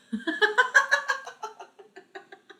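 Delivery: high, rapid burst of laughter; amused laugh. Real, not acted. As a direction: break into a high cackling giggle. Amused by own accent.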